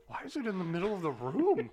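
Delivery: baffled question climbing high; puzzled outburst, played for laughs; mock bewilderment. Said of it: "and I'm like".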